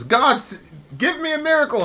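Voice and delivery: high, pleading cry to the sky; desperate prayer. Retold or imitated. Joke's stranded man begging God for a miracle, high pleading. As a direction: beg God for rescue in a high voice.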